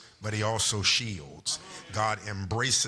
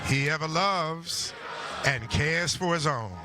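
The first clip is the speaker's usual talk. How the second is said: higher, deliberate declaiming with long pauses; preacher voice; 7 semitones higher than usual. Acted. Pastor modelling hymn lines for the congregation, preacher voice. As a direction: preach each hymn phrase out, stretching the key words.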